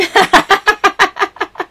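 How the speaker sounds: bursts out laughing high; a happy high laugh at the gift; delighted laugh. Real, not acted. Vlogger laughing to viewers over the card, delighted laugh.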